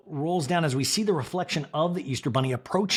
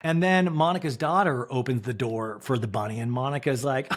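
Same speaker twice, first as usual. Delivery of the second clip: ordinary talk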